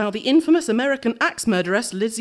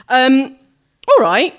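ordinary delivery, nothing marked

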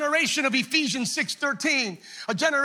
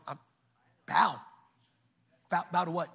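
Shadrach voice; 7 semitones lower, 6 dB quieter than usual. Acted. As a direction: lower and softer, confused. Shadrach voiced in retold story.

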